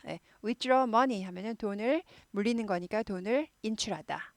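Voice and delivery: ordinary talk, nothing marked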